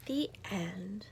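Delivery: soft whispered ending. Acted. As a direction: close the story gently.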